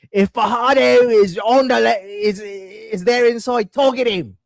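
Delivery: imitating a New Zealand coach's Kiwi accent; light New Zealand accent impression; mock Kiwi accent. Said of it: "do not take any offense in the accent".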